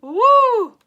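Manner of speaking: high, loud "woo" after laughing; shrill-pitched whoop to recover from giggles. Real, not acted. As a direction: let out a big releasing whoop.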